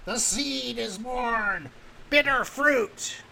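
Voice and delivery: high, drawn-out wailing shriek; eerie high creature cry; shrieking plant face. Acted. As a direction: shriek it slowly, stretching every word. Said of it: "it sort of shrieks".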